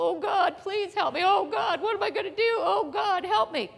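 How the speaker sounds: high, loud desperate wailing to God; mock panicked plea. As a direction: ham up a frantic prayer. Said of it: "Here's how we talk in trouble".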